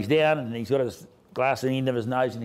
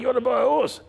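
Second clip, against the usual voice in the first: imitating old Den in a much higher voice; a high, louder mock call across the yard; 12 semitones higher, 3 dB louder than usual. Old Den yelling to Rob across the trials.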